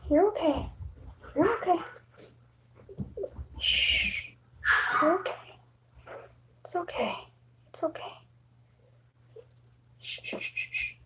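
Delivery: fading soft coos down to a whisper; hushing a scared cat. Real, not acted. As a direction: settle the cat with hushes.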